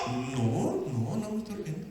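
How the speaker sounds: very soft, low little denial; mock frightened denial. Preacher as Sara caught laughing by God, meek acted denial. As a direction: imitate someone timidly backing down. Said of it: "Se asustó cuando Dios dijo".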